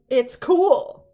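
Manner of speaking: excited squeal. Real, not acted. Excited over vintage book.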